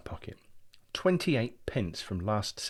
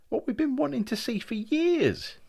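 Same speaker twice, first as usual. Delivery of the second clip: much higher, louder, thrilled; excited gushing; 7 dB louder than usual. Acted. Brian reliving the outing to his brother, excitedly. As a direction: gush with delight.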